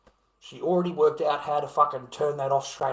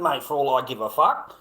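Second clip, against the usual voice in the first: normal talking voice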